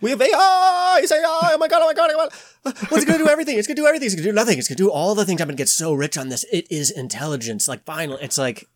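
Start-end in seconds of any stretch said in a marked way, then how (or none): 0.0-7.7 high, loud squealing tech-bro excitement; mock exuberant hype. Podcast bro mocked as giddily gushing over AI. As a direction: gush like a giddy billionaire about AI. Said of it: "they're so exuberant".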